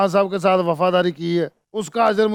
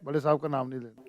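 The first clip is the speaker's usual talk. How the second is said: hushed warning; 6 semitones lower, 9 dB quieter, faster than usual. Retold or imitated.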